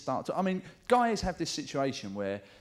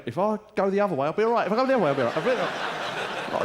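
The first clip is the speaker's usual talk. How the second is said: put-on a raised, louder drunk bloke steadying himself; drunk inner voice; 7 semitones higher, 5 dB louder than usual. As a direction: talk yourself through staying upright, half laughing. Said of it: "most of the thoughts are going".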